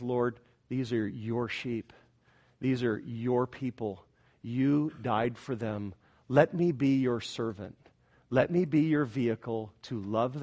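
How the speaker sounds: hushed, humble recited prayer. Retold or imitated. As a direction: pray quietly, pausing after each petition. Recites his private prayer.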